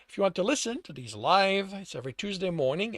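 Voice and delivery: ordinary delivery, nothing marked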